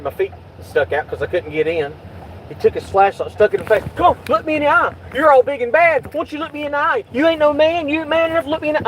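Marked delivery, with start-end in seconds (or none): 3.9-8.9 much higher, mocking taunt; mock the cop taunting him. Imitating the cop taunting him in the car: much higher mocking taunt. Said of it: "he was taunting me".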